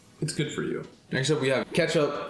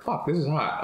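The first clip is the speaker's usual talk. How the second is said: normal talking voice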